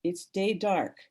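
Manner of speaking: measured poetry reading voice; solemn reading. Acted. Reads poem aloud.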